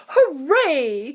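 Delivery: high, loud cheer; cried hooray. A narrator's reading. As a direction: celebrate with glee. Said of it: "cried Mister Wonka".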